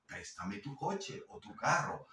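ordinary delivery

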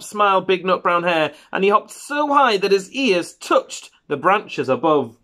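unhurried picture-book narration with lifted key words; children's story reading. A narrator's reading. Parent reading a bedtime hare story to a child, storytelling. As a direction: read it to a little child, savouring the phrases.

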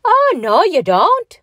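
high, loud, panicky refusal; alarmed cry. A narrator's reading. Patrick balks at entering the helicopter, alarmed cry. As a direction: balk loudly in alarm. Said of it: "he cried in alarm".

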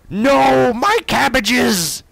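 high, loud, slow wail; cabbage merchant. Retold or imitated. Quoting the Avatar cabbage merchant's anguished cry. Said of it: "if anyone gets that reference".

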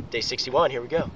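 soft, low, under the breath; quiet, low resolve. Real, not acted. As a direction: say it quietly to yourself, steeling up. Quiet restart before push-ups.

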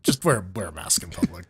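soft unvoiced whisper. Real, not acted. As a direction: whisper it under your breath. Whispered plea after joke.

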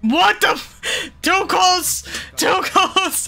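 high, loud startled cries; startled yelp. Real, not acted. Player jolted by the nurse jump scare, high startled yelps. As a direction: jump back in real fright.

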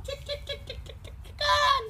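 high soft chick chick call; calling chickens. Real, not acted.

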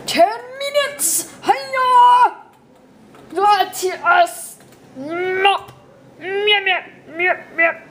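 high, drawn-out squeaky alien voice; a silly made-up alien creature. Pretend. Kid doing an alien voice for his viewers. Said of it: "I'm trying to sound like a Chinese".

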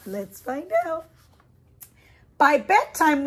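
usual speaking voice

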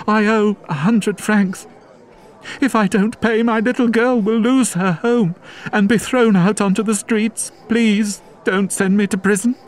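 high, desperate woman's plea; pleading woman. Acted. Fantine begging Javert to spare her, high and desperate. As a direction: beg him in a high voice.

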